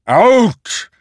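very high, loud bellowed order; commanding shout. Acted. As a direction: shout them out of the room. Posen repeats the order.

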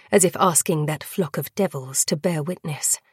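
usual speaking voice

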